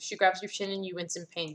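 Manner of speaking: normal talking voice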